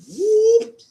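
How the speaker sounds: sudden high, loud yelp of surprise; startled whoop. Real, not acted. Streamer nearly slipping off a step in the store, startled yelp. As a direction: yelp as your footing goes.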